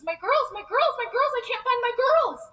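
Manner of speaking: high, loud, desperate outcry; panicked cry. Retold or imitated. Mom searching the empty bus for her daughters, panicked. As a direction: cry out as a frantic mother. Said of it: "panics and says".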